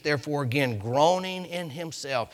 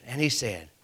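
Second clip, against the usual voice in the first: ordinary talk, nothing marked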